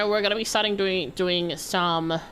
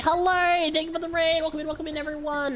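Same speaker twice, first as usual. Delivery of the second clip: high excited greeting; cute anime streamer; 10 semitones higher than usual. Real, not acted. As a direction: greet the raiders up high and eager. Greeting an incoming raid.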